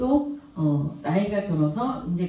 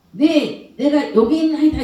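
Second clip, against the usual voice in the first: eager pledge; 6 semitones higher, 6 dB louder than usual. Pretend. Ideal answer to God's call.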